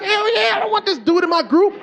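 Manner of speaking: high, indignant comic outburst; mad. Retold or imitated. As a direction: act out an exasperated dad, voice shooting up. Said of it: "I was already mad".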